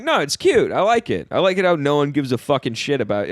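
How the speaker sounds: high, fake-sweet sarcasm; mock cheerful, secretly furious; sarcastic. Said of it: "it's funny in a mad tone".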